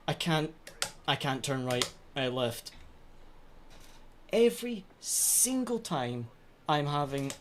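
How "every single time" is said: high, slow, drawn-out lament; whiny wail. Real, not acted. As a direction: stretch it out in despair. Repeated complaint, wailed take.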